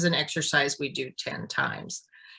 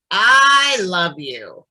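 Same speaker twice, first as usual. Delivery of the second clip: "I love you" slow and louder, stress on "I"; intent with intonation; 7 dB louder, much slower than usual. Shown as a demonstration. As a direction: lean hard on "I" in "I love you", slowly. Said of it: "So I'm gonna do just the first three here".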